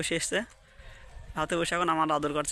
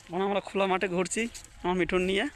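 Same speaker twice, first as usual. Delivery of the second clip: ordinary talk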